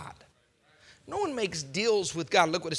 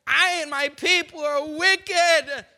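louder, slow, higher hammed-up confession; loud mock confession; 7 semitones higher, 10 dB louder, slower than usual. Preacher voicing Pharaoh, loud and slow. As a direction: overact a wicked king's confession.

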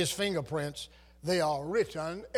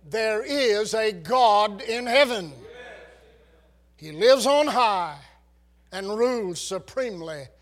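preaching at full force; 3 semitones higher, 7 dB louder than usual. Acted. Preacher declares God exists.

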